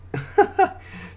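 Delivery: quick, high burst of laughter; self-mocking laugh. Real, not acted. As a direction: laugh off your own fumbled words. Laughs at own fumbled sentence.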